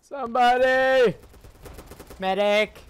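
desperate yell for a medic; man down shout. Real, not acted. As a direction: call out loudly for help, drawn out. Downed player calls for a medic.

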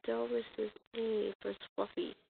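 ordinary talk

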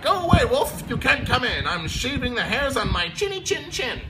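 a frightened little pig shouting from inside; yelling pig. A narrator's reading. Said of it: "He yelled back".

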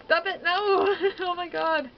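loud panicked yell into laughter; shocked laughter. Real, not acted. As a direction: shriek then laugh it off. Panicked game moment, laugh.

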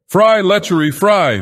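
high, loud frenzied scream; frenzied shriek. A narrator's reading.

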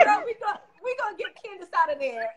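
high, excited voice through laughter; laughing retold promise. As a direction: imitate the resident cracking up. Acting out resident while cracking up.

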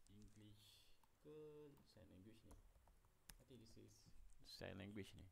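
very soft, puzzled muttering; hushed aside, barely audible; muttering to self. Real, not acted. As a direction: think aloud almost inaudibly, unsure. Puzzles to self over ESL acronym.

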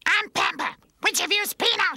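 put-on very high, loud shrieking baby; horrible baby voice. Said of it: "in that horrible baby voice".

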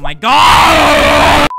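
huge high-pitched, drawn-out scream; frustrated scream. Acted. Player failing the same way, long frustrated scream. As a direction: scream it and hold the last word.

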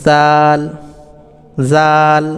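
জাল with a thin tongue-tip z and long aa; thin letter sound. Shown as a demonstration. Teacher showing learners the letter, thin drawn-out জাল. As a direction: say জাল thinly, tongue tip on upper teeth edge. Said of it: "পাতলা আওয়াজ বের করবেন".

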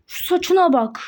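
usual speaking voice

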